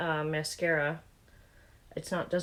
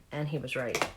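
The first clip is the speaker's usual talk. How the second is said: ordinary talk, nothing marked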